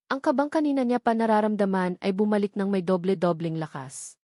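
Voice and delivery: ordinary delivery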